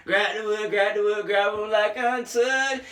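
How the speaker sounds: lightly voiced rapper impression. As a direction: rap it the way the artist would, only a little shifted. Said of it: "picture in [name] saying that".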